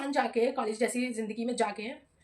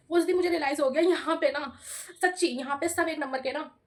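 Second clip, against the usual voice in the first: ordinary talk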